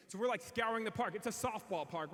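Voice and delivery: normal talking voice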